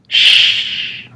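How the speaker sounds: a faint hiss from the dark; faint shush. Retold or imitated. Imitating the mysterious shush heard in the dark. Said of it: "It sounds like this".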